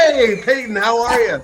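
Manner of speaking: fanboy thrilled to get the call; excited greeting. Retold or imitated. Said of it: "you know".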